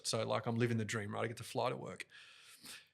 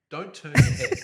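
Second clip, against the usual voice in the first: much higher and louder, laughing burst; mic-drop demo; 12 dB louder than usual. Shown as a demonstration. Host demonstrating lectern mic technique with a yelp. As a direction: blurt it out mid-demo. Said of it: "So you'll hear my voice go down".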